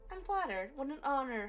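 high, playful teasing; mock flattered. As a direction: tease her with fake gratitude. Teasing reply to Piper.

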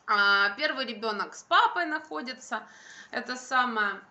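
ordinary talk

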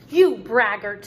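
loud, emphatic storybook accusation; exclaiming. Acted. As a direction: read it out as Wendy's indignant exclamation. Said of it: "she exclaimed".

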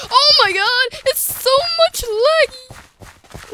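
high-pitched, drawn-out complaint; exasperated outburst. Real, not acted. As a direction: cry out in frustration at the lag. Complaining of game lag.

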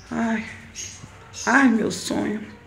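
soft, slow wistful sigh; suspiro sonhador. Real, not acted. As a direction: sigh it out quietly. Suspira desejando família discreta. (suspirando baixinho)

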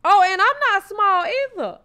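loud laughing aside. Real, not acted. Co-host joking about her own size, loud and laughing. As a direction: laugh through the self-dig.

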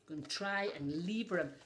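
usual speaking voice

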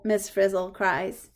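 ordinary delivery, nothing marked

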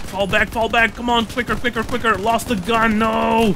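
high urgent battle calls; shouting orders over battle. Real, not acted. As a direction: bark quick orders under fire. Urgent calls during firefight.

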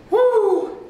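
high happy woo; excited whoop. Real, not acted. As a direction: cheer it out. Cheer after set.

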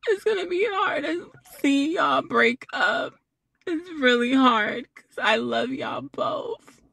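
high, half laughing, half crying; mock tearful farewell. Real, not acted. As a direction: fake a tearful goodbye through laughter. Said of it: "They said you're not crying".

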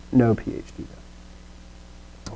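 very soft, drawn-out, near-whispered; barely voiced, slow emphasis; hushed repeat. Real, not acted. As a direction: let it sink in, hushed and slow. Repeats the point for effect.

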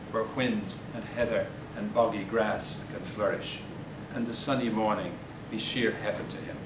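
ordinary talk

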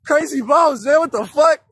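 normal talking voice

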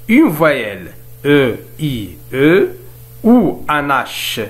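slow, spaced-out reading of a rule; slow dictation. Shown as a demonstration. Teacher dictating the grammar rule to the class, slow and spaced. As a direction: dictate it so students can write it down. Reads the French rule slowly.

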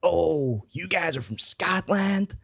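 slow, mock Scottish accent attempt; bad Scottish accent. Receptionist to Scots, bad Scottish accent. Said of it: "an attempt of the Scottish accent".

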